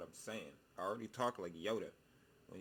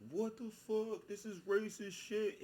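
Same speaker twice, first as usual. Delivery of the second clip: high, loud outraged squeal; mock outrage pitched way up; outraged quote; 13 semitones higher, 5 dB louder, slower than usual. Caught suspect shouting in high outrage. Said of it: "you're gonna be like".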